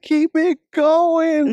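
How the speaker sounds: high, long drawn-out cheer; hyped-up encouragement; retold excited urging. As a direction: call it out high and stretched, egging them on.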